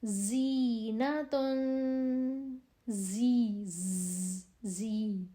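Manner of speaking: زینتن with a thin, hissing ز, drawn out very slowly; زی with a sharp hiss; whistling z. Shown as a demonstration. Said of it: "سیٹی والی آواز".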